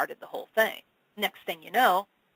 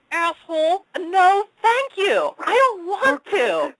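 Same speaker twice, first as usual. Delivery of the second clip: mock whiny refusal; 12 semitones higher, 7 dB louder, much slower than usual. Retold refusal, high loud whine.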